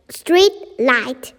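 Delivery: plain reading voice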